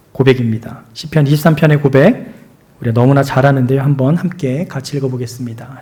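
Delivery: ordinary talk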